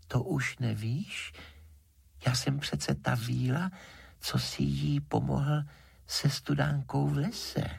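high-pitched, patient fairy voice; light high voice of a well sprite; water fairy. A narrator's reading.

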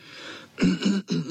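showy throat clearing. Acted. Cvoček gets ready to tell a story, clearing throat. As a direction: clear the throat before a recital. Dachshund prepares to narrate.

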